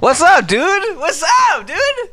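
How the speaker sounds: high yelled what's up; shouted greeting. Acted. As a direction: yell it out high and loud.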